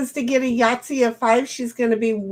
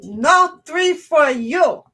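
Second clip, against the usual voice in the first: slow, high, hammed-up Indian accent; hammy Indian accent, slowly; 5 semitones higher, 5 dB louder, slower than usual. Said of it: "my best Indian accent ever".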